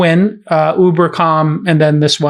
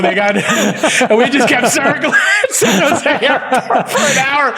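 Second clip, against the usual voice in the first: higher, laughing through the words; can barely get it out for laughing; giggling high as the story lands; 8 semitones higher than usual. Real, not acted.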